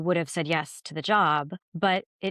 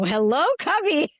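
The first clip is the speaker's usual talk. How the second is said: teasing voice from the room; 10 semitones higher, 5 dB louder than usual. Retold or imitated. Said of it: "someone in the room go".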